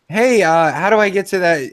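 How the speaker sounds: pretend asking directions. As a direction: act out hailing someone for directions.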